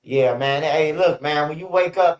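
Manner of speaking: usual speaking voice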